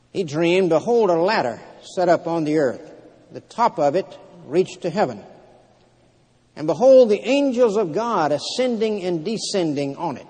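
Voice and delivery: preacher reading scripture aloud, pitch lifted. Acted. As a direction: proclaim the verses to a congregation. Reads scripture aloud to congregation.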